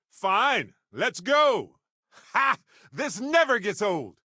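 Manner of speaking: high, eager rush of excitement; gleeful battle cry; thrilled. Acted. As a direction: egg everyone on, loving the thrill. Thrilled at a daring move.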